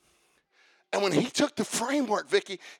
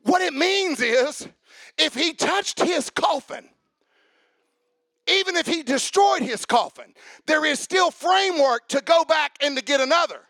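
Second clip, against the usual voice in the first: preaching, building; 5 semitones higher, 5 dB louder than usual. Acted. Preacher explaining to the church, rising to a shout.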